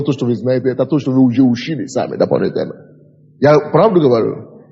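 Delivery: ordinary talk, nothing marked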